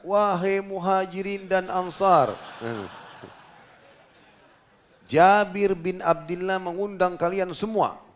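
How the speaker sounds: slow, high, loud call to the crowd; retold shout from the rock. Prophet calling out to the gathered army, loud slow proclamation. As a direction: proclaim it slowly to a thousand men. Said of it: "lalu beliau teriak".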